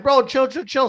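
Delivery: high, loud, hurried-sounding plea; urgent calming plea. Retold or imitated. Hustler holding back his mad trainee, urgent plea.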